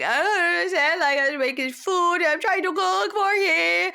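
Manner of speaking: high, loud tearful wail; crying. Retold or imitated. Wife crying alone at the kitchen table, tearful wail. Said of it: "She's crying at the table".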